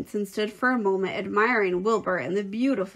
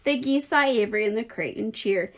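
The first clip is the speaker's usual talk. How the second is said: usual speaking voice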